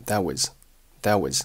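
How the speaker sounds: "that was" light and reduced; weak forms. Shown as a demonstration. Teacher demonstrates weak forms, "that was" said lightly. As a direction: say "that was" weakly, t unreleased. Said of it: "that was都是弱读".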